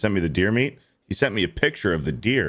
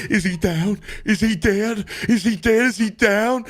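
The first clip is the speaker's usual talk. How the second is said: mocking a panicked hunter in a much higher, strained voice; panicky high voice; 13 semitones higher than usual. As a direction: squeak it out like a frantic, scared celebrity hunter. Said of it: "He's like".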